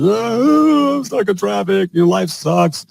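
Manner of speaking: high mock moan, easing down as it goes; whining and moaning. Host mimics plumbers stuck in traffic, whining and moaning. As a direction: put on an exaggerated, high-pitched whine. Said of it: "whining and moaning".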